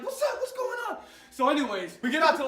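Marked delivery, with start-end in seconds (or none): 0.0-1.0 hyped. Retold or imitated. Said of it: "I'm like".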